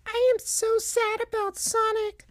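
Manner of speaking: tiny, very high falsetto voice; Knuckles Junior, sad. A narrator's reading. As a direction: squeak sorrowfully like a little cartoon kid. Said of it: "said Knuckles Junior".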